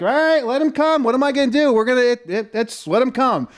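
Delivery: retold don't-care shrug to the police; carefree defiance. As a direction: dare them to show up. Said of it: "I'm like".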